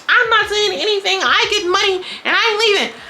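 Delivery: very high-pitched mimicked retort; mock defiance. Retelling Vanilla's answer to the women, high mock defiance. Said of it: "She like".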